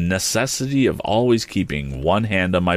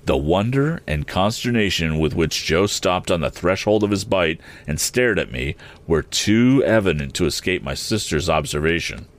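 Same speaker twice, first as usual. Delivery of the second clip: usual speaking voice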